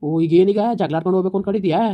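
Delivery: loud, fast angry challenge; angry shout. Acted. Husband accuses wife of picking a fight, shouting fast. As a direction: fire it at her fast.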